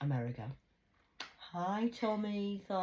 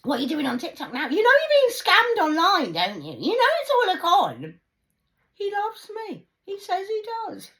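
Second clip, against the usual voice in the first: much higher, louder nagging relative; mocking family nagging; 11 semitones higher, 12 dB louder than usual. Mocking her family nagging about TikTok, shrill and loud. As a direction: nag like a scolding relative. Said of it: "My family".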